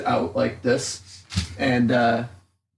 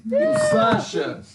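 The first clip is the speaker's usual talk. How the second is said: put-on lightly done Russian accent speech; rally the crowd in a Russian accent; 4 semitones higher than usual. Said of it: "I have a Russian accent".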